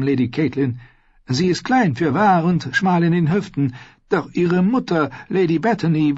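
high, chummy maester voice; cheerful old scholar, reassuring her; jolly fat maester. Acted. Dicker freundlicher Maester spricht. (hohe, gemütliche Maester-Stimme)

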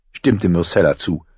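ordinary delivery

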